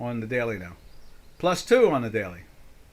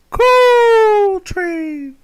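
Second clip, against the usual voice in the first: much higher, loud shouted call; 13 dB louder than usual. Pretend. Announcing the coal sector like a conductor, loud shout. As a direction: bellow it like a train conductor.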